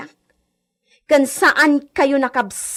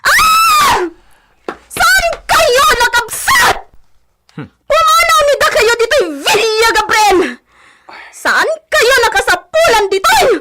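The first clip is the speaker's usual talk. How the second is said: furious; 8 semitones higher than usual. Acted. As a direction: scream them out of the house. Sister screams them out of villa.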